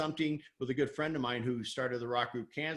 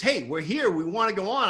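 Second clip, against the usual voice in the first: louder and higher, insisting on being heard; pushy repeat; 6 semitones higher, 7 dB louder than usual. Retold or imitated. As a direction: push the request harder the second time. Guy asks again, pushier.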